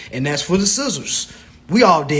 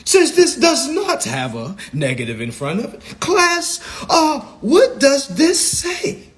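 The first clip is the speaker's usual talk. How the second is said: teacher voice; 8 semitones higher than usual. Shown as a demonstration. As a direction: quiz the class like a teacher. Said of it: "oh, I gotta put my voice back on".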